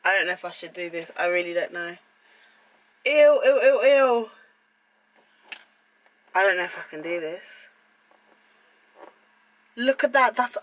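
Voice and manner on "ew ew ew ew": loud, higher squeals of disgust. Real, not acted. Filming her own haircut, squealing in disgust. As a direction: recoil from the hair with a loud ew. Disgust at hair being cut.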